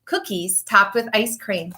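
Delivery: lively, sing-song picture book reading; reading to children. Acted. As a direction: read it like storytime for little kids. Teacher reads picture book aloud.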